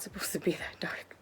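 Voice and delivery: whispered aside; a guilty whisper to the viewers. Real, not acted. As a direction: breathe it as a hushed aside. Aside after dark joke.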